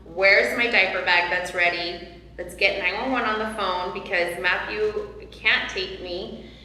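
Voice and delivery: ordinary talk, nothing marked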